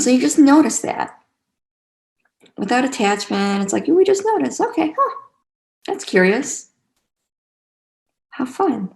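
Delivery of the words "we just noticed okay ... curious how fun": high, airy mock amazement; light curious wonder. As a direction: play it lightly intrigued, like finding a small surprise. Acting out a curious inner reaction.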